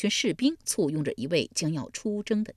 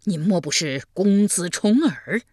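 slow, louder, dawning amazement; astonished recognition; 2 semitones higher, 6 dB louder than usual. A narrator's reading. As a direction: ask it as the realisation hits. Old man recognises the prince. (惊讶地认出，放慢并加重语气)

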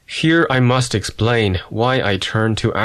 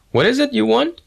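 sharp, much higher puzzled demand; a baffled clerk pushing back. Acted. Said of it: "the sales clerk demanded".

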